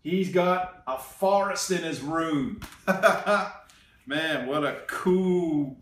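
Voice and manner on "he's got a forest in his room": plain reading voice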